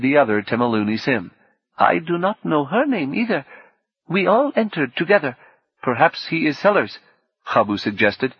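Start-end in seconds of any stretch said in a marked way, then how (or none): 1.8-7.0 high-pitched, careful character voice; woman guest's voice. Acted. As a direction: play an unsure guest, pitched up.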